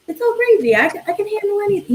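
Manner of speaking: put-on carefree childhood optimism. Recalling her cheerful kid self, brushing off every obstacle, high and breezy. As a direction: toss it off like nothing could ever go wrong.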